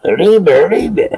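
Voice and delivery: high hammed-up Arriba, arriba echo; mock cartoon Mexican. Echoing his friend's phone story, high mock cartoon cry. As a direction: join in the cartoon mouse cry.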